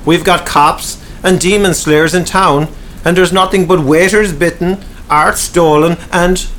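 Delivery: frustrated mayor berating his men; agitated. Acted. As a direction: rant at them, exasperated and rising. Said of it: "Thurlock was very agitated".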